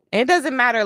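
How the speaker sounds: usual speaking voice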